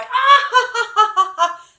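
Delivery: high, loud cackling parrot laugh. Retold or imitated. The parrot laughing at the boy, high loud cackle. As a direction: cackle like a bird mocking a kid. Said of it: "laughing and carrying on at him".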